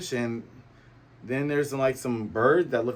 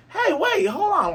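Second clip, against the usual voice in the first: very high, loud squeal; panicky Buggy; 5 dB louder than usual. Retold or imitated. Buggy begging Luffy to stop while pinned down, high panicky squeal. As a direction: beg for a pause in a hammed-up panic.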